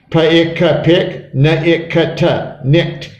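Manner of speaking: Pa-ik-ka, pick, Na-ik-ka-ta, nicked, sound by sound. Shown as a demonstration. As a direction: blend the sounds, adding t to make nicked. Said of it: "so E D sounds like t".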